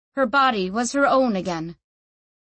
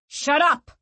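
higher, sharp angry snap; snapping; 7 semitones higher, 4 dB louder than usual. Acted. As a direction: snap it at her. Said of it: "snapped Tomas".

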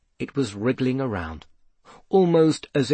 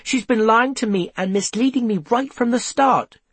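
high, heated protest; blustering; 9 semitones higher than usual. A narrator's reading. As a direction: protest indignantly, pitch raised throughout. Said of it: "Seth blustered".

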